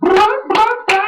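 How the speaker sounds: high, whiny mocking impression of Paul Heyman. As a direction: squeal it like a crying fan-boy manager. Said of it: "Paul Heyman was like".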